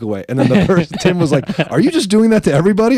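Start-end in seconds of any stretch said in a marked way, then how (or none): 1.7-3.0 high, fast, louder question; incredulous. Retold or imitated. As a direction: fire it off in disbelief. Tim asks incredulously.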